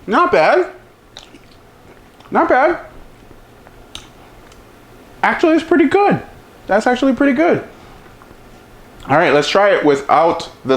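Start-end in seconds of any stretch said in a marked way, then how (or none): 0.1-7.7 much higher, delighted surprise; high-pitched not bad, actually pretty good; pleasantly surprised. Real, not acted. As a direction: caught off guard by how good it is. Tasting, pleasantly surprised by wing.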